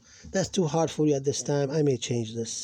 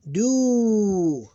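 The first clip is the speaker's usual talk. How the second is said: long vowels held high and steady; syllable-by-syllable recitation; 9 semitones higher, 9 dB louder than usual. Shown as a demonstration. Teacher reading Arabic syllables aloud, measured recitation. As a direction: chant each syllable apart, stretching long vowels.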